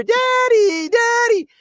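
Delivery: put-on high child's cry of Daddy; little girl calling. Three-year-old calling her dad from the rolling car, high and childlike.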